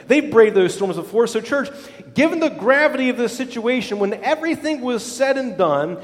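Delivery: ordinary talk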